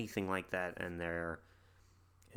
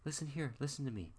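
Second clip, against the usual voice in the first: lightly voiced boy coaxing, higher; 7 semitones higher than usual. Boy coaxing the fleeing creature to come back, higher and sweet. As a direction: sweet-talk the creature into staying, pitched higher. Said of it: "and he's like".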